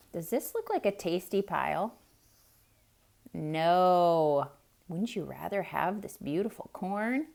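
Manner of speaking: ordinary delivery, nothing marked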